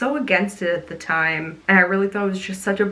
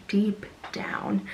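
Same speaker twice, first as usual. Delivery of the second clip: soft unvoiced whisper of deep down; deep down whispered to yourself; whispered echo; 6 dB quieter than usual. Real, not acted. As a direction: breathe deep down as a hushed aside.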